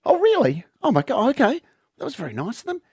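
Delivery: high, hammed-up surprised wife; softened wife. Wife accepts her husband's excuse, high mock surprise. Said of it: "And she goes".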